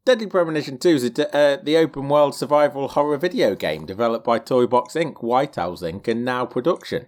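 hurried read-aloud, starting high; reading off search results. Real, not acted. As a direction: rattle it off as if read from a screen. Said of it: "I'm reading from my Google results".

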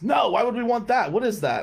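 high, loud mock outrage; indignant mechanic. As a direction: imitate a worker scoffing at a dumb offer. Mechanic rejects email offer indignantly.

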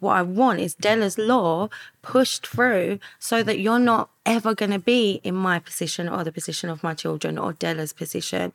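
ordinary talk

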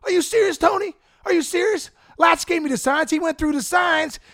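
Ricky pleading his innocence, loud and high; high-pitched indignant Ricky voice, rising to a shout; Ricky Henderson impression. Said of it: "He's like".

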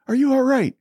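very high, urgent acted question; high-pitched retold worry; frantic father. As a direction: act out a dad pleading to be sure. Retold frantic father asks once more.

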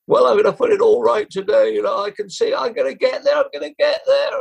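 high-pitched mock riding commentary; race commentary. Jockey bursting through on the long run-in, mock excited commentary. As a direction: re-enact commentating at the gallop, pitched right up. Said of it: "doing a commentary, you know, and saying".

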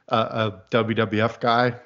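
ordinary talk, nothing marked

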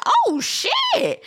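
high-pitched shocked yelp with a laugh; hammed-up squeal of shock; retold shock. She reacts to him calling Robin stupid, hammed-up shock.

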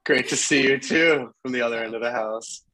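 distant, roomy sound; talking from down the hall; from another room. Real, not acted. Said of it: "Welcome from the other room of our house".

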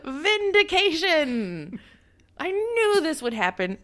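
high, slow hammed-up triumph; gloating dad parody. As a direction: crow over being right like a cartoon father.

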